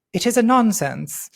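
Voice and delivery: put-on slipping into an English accent. Reading Rowling's tweet aloud, English accent. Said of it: "without doing it in an English accent".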